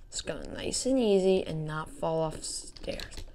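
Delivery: normal talking voice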